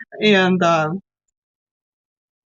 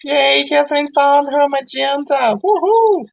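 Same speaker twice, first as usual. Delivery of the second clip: high, celebratory whoop; excited cheer; 8 semitones higher, 3 dB louder than usual. Real, not acted. Teacher cheering a student's find, high excited cheer. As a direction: cheer them on brightly, pitch raised.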